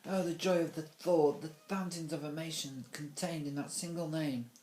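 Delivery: high, loud, overwrought gushing; hammed-up weeping adoration; crying with rapture. A narrator's reading. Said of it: "and began to cry".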